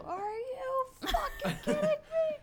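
very high, slow, laughing scream; shrieking outburst. Pretend. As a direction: stand up and shriek it. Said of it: "I stand up and go".

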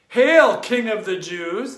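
loud, high sneering hail; mock the prisoner with a loud snarky hail; snarky mockery. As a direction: jeer it like a cruel soldier. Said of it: "in the most snarky voice".

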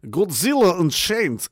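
ordinary talk

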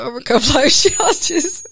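breaking into a laugh mid-phrase; fighting laughter while reading the intro. Real, not acted. Co-host telling listeners the podcast's purpose, laughing. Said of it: "Sorry, we laughing".

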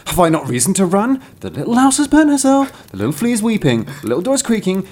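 high, rising storybook protest; indignant little cart. Acted. Cart answers the garbage heap, indignant high protest.

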